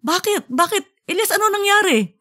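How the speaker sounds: high, fast, worried wife's voice; a flustered wife rushing in, pitched high; alarmed wife. Acted.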